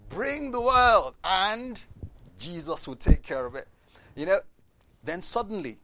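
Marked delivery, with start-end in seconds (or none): 0.1-3.7 loud, high and slow, chest out; triumphant declaration, stretched out; confident boast. Retold or imitated. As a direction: proclaim it like you own the world. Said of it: "so confidently to say".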